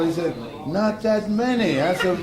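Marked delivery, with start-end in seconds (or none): 0.7-1.8 high, loud, drawn-out comeback; acted retort. Coach answering the pitcher, acted retort. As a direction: stretch out a mock-exasperated objection.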